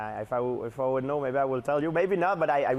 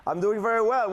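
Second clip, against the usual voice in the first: higher-pitched mock host reply; Michael Landsberg; 8 semitones higher, 4 dB louder than usual. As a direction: imitate the host, lifting the pitch. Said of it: "I wanna be you".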